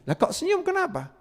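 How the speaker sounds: high, questioning visitor's voice; puzzled visitor. Retold or imitated. Visitor puzzled why the sick man smiles, high querying voice.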